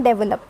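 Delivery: डेवलप as DEV-uh-lup; डेवलप the common mistaken way, DEV first; stress on first syllable. Shown as a demonstration. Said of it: "हम usually इस word को बोलते हैं".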